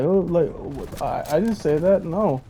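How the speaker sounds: lightly voiced timid denial; cowering denial. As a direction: shrink away from the accusation. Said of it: "he just cowered away".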